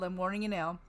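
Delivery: ordinary talk, nothing marked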